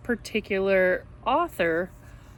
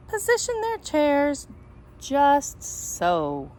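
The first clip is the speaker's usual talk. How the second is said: slow, animated read-aloud voice; storybook reading; much slower than usual. Acted. Teacher reading a picture book to her students, animated storytelling. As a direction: read it like story time for little kids.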